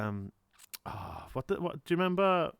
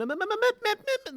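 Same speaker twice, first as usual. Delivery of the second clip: high, rapid stuttering m sounds, mocking a singer; mock vocal stutter; 3 dB louder, faster than usual. Mocking [name]'s vocal tic with high m-m-m sounds. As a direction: mimic a singer's choppy stutter, pitched way up. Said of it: "she does that weird m".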